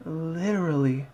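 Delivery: a timid high whisper of agreement; Fluttershy voice. Acted. Fan voicing Fluttershy shuddering, soft and high. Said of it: "Fluttershy shuddered".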